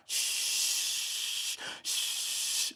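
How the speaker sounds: soft unvoiced shh, shh; shushing. Mimicking silence on the phone with a soft shh. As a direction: hush the line like dead air.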